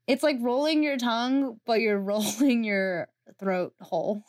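usual speaking voice

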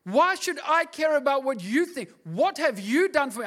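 loud, high and defiant; defiant realisation. Retold or imitated. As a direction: throw it off with bold defiance. Defiant quoted thought.

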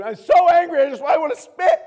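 high, loud angry outburst; hammed-up kid raging at dad; mock angry kid. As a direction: vent it like a furious child. Child venting anger to dad.

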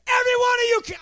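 high mock yell at the class; retold yell. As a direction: bark it at unruly kids, then cut off. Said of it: "I, I yelled".

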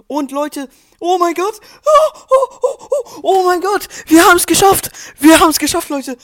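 screaming with joy, very high and loud; shrieking jackpot winner. Real, not acted. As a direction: shriek it out like you just won. Winning the final round.

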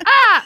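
sudden high shriek of fright; startled scream. Pretend. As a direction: shriek like you just spotted someone. Mock fright at car intruder.

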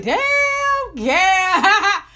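high, loud startled cry; shocked exclaim. Retold or imitated. Reacting to the diss line about Gabs, high startled cry. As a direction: gasp out the name in shock. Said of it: "I was like".

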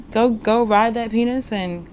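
ordinary talk, nothing marked

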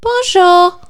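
Bonjour sung up high and loud; high-pitched bonjour. Shown as a demonstration. S0 to S1, asked for her best bonjour, squeaky. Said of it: "do your best bonjour".

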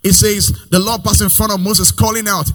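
high, half-sung reading over the music; preaching cadence. Acted. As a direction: lift the verse into a chant.

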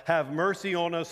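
loud, pleading call for mercy. Retold or imitated. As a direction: raise the voice to call after someone. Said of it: "and they calling out".

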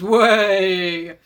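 low American cart driver; mock American accent. American horseman greeting a lost woman, mock American accent. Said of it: "I don't know why he's American".

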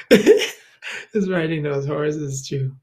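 slow, laughing through the words. Real, not acted. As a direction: chuckle your way through it. Laughing while recalling the horse ride.

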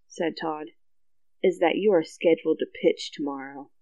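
measured, low-key storybook reading; story narration. A narrator's reading. Telling a friend The Bad News, story narration. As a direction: read it aloud evenly, holding back the punchline.

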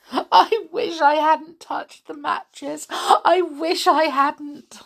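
mild sob through the words; remorseful doll sobbing; sobbed. Acted. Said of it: "she sobbed".

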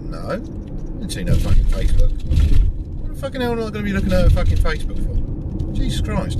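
fast, fed-up mock rant; exasperated rant. Snapping at his mate over the Facebook, fast and fed up. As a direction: rattle it off in disbelief.

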